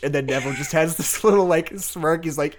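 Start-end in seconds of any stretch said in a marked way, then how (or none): none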